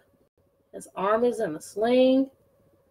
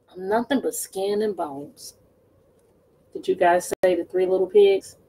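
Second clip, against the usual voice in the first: usual speaking voice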